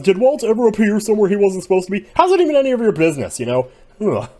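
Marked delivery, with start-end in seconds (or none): 0.0-3.4 high, loud, fast exasperated rant; indignant rant. Real, not acted. Fan ranting straight at the Others about Walt, indignant. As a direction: fire the questions at them in disbelief.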